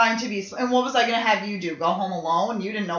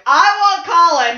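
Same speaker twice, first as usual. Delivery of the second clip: high, loud mock declaration; excited retold shout; 7 semitones higher, 7 dB louder than usual. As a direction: call out your pick, loud and high. Excited retold claim of a boy.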